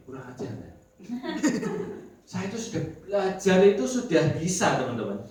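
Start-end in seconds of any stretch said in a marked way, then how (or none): 1.0-2.1 high laugh. Real, not acted. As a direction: burst out laughing. Laughs at the insult. (tertawa tinggi)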